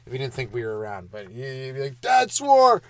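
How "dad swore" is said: high, loud mock scandalised outcry; shocked kids. Children catching their dad swearing, loud mock shock. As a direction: tattle it out at full volume.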